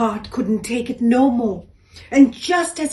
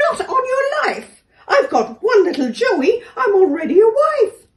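indignant kangaroo; 13 semitones higher, 7 dB louder than usual. A narrator's reading. Kangaroo refuses the door.

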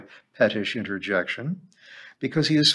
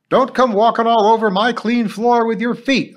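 put-on much higher, louder shout at the kids; 11 semitones higher, 8 dB louder than usual. A narrator's reading. Cleaner shouting at the oncoming children over her clean floor, much higher and louder. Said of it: "I heard her shout".

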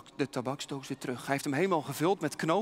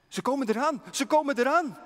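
higher and louder, breathless alarm; alarmed warning; 9 semitones higher, 8 dB louder than usual. Acted. Hottentot rushing to warn the missionary, alarmed and higher.

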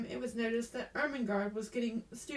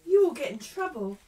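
higher, much louder exclamation; alarmed warning; 4 semitones higher, 10 dB louder than usual. A narrator's reading. Sarah to Ermengarde found out of bed, alarmed. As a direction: warn her urgently, with startled force. Startled Sarah warns her friend.

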